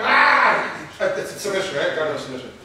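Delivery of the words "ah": high loud yelp of alarm; startled yelp. Retold or imitated. Opponent caught in the submission yelping loudly. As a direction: yelp like you just got caught. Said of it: "and he went".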